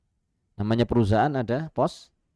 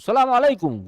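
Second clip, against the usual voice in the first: hurried greeting; 12 semitones higher, 5 dB louder than usual. Retold or imitated. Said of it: "Suatu saat dia tergesa".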